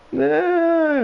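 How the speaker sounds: a high, hammed-up "nah"; silly high voice. Gamer answering his own question, high cartoon squeak.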